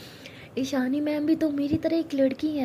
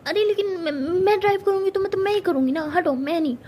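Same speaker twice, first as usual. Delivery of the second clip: stubborn demand; 5 semitones higher, 6 dB louder than usual. Acted. Pari demanding to drive.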